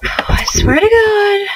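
slow, high, exasperated cry; fed-up cry over the broken dishwasher. Real, not acted. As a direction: drag it out in frustration.

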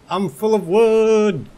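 high, slow, goofy boast; silly high voice. Acted. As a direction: stretch it out in a cartoon voice. Proud silly boast to viewers.

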